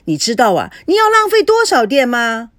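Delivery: loud, high nagging call across the kitchen; yelling about the open fridge door; scolding shout. A narrator's reading. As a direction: scold her like a fussy landlady. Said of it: "见状喊着说".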